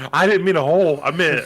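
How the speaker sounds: imitating a mother covering up, lightly voiced; mock flustered lady. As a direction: backpedal nervously. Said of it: "And then she looks and goes".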